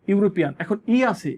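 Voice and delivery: ordinary talk, nothing marked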